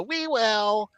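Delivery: put-on high, singsong child reply; high-pitched kiddie chorus; little kids. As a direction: chirp it back like obedient kids. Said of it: "And they go".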